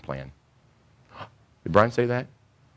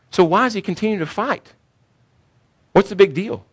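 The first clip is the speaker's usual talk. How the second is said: rhetorical challenge; 11 semitones higher than usual. Real, not acted.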